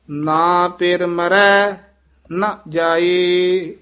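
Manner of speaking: measured, drawn-out devotional chant; scripture recitation. Shown as a demonstration. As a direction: recite steadily, holding the line endings. (ਠਹਿਰਾਅ ਵਾਲਾ ਗੁਰਬਾਣੀ ਪਾਠ)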